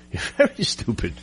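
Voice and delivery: laughing insult. Real, not acted. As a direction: laugh through the words you're very stupid.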